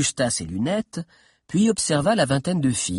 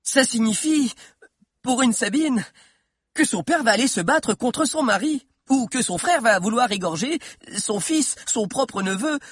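high-pitched, climactic explanation; excited young storyteller at his peak; triumphant; 10 semitones higher than usual. A narrator's reading. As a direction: drive the point home phrase by phrase. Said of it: "triompha Valère".

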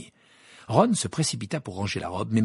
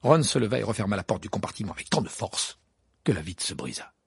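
plain reading voice

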